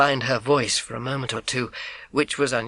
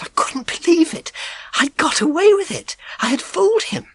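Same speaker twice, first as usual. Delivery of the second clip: thrilled; 4 dB louder than usual. A narrator's reading. Triumph after fooling milkman.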